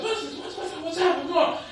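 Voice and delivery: high, hushed string of questions; questioning David. Acted. David asking the men around him, questioning. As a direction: fire off high, soft, stammered questions. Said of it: "David was asking questions".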